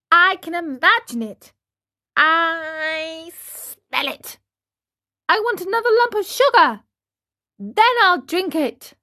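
put-on high, wheedling child; whiny puppet boy. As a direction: bargain like a fussy little marionette.

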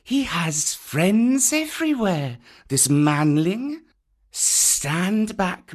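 calm snake taking charge; commanding python. A narrator's reading. Kaa speaking.

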